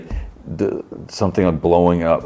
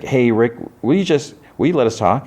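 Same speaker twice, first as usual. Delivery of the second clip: higher-pitched, coaxing appeal; retold pointed request; 6 semitones higher than usual. Harcourt asking Rick to let them talk, pointedly coaxing. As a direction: politely press him to back off, pitched up.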